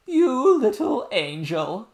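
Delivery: high, loud, slow loving nurse voice; tender nurse cry. A narrator's reading. Nurse hugging the princess, crying out fondly. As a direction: gush over the child with love. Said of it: "cried the nurse".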